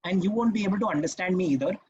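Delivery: rapid nonstop run-on; hurried, unpunctuated rush; fast without pauses. Shown as a demonstration. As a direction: race through without stopping for breath. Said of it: "speak at this kind of a speed without stopping".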